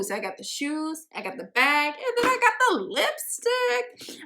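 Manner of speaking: giddy list peaking high on "lipstick"; playful excitement. Retold or imitated. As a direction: climb in pitch with each item. Gleefully listing her matching collection.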